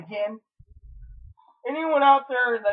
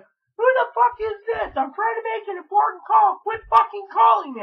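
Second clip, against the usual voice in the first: retold angry shout at a caller, high and loud; angry yell; 8 dB louder than usual. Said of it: "getting angry".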